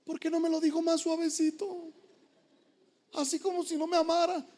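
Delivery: soft whiny imitation; pouting patient. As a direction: sulk that the doctor wasn't gentle. Patient wants gentler doctor.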